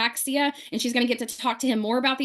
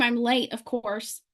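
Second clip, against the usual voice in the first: ordinary talk, nothing marked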